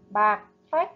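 Bạc phách with a crisp ếch ending in phách; careful pronunciation. Shown as a demonstration. Teacher modelling Bạc phách for pupils to repeat. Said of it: "chú ý phát âm ở vần ếch trong tiếng phách".

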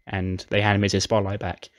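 ordinary talk, nothing marked

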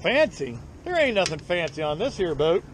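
ordinary talk, nothing marked

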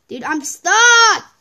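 loud, high, drawn-out complaint; exasperated shout. Real, not acted. Player trapped in the game, exasperated shout. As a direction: yell it in frustration, stretching the last word.

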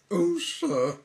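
high, loud, squeaky little cry; sad squeaky voice. Acted. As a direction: squeak it out sadly like a small creature. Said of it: "Sad, squeaky sort of voice".

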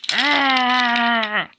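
long, growled yell; fighting roar. Acted. Engine battling a rival, long roar. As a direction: roar through the effort of the fight.

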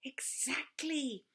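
slow whispered snake hiss. A narrator's reading. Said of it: "hissed Snake".

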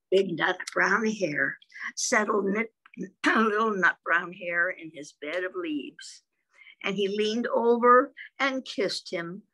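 ordinary delivery

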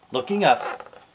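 usual speaking voice

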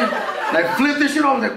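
ordinary talk, nothing marked

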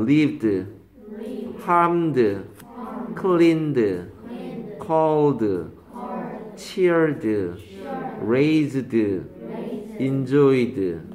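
word-by-word drill, endings sounded as D; final D sound. Shown as a demonstration. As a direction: model each word for repeating, with a released D at the end. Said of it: "드를 발음되는 경우도 있대".

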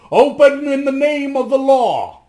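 calling out. Acted. The watch outside calls on them to open, loud and commanding. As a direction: call through the door like the city watch. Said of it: "voices called to them".

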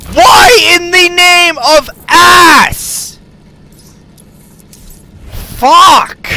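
high loud, slowly stretched outcry; real frustrated yell; exasperated rage. Real, not acted. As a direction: wail at the game in frustration. Ranting at the game.

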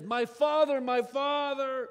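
loud, slow grieving cry; the king crying over his dying prophet, loud slow wail; anguished cry. Acted.

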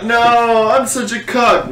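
high, drawn-out hammed-up wail; exaggerated self-pitying cry; mock anguish. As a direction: whine it slowly like a comic lament. Acted distraught reaction in hypothetical.

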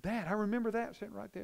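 a hint of her glee; quoting the daughter. Daughter quoted to her dad.

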